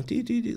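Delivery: high, soft little beeps; imitated beeping. Telling how the delivery room monitor went off, imitated beeping.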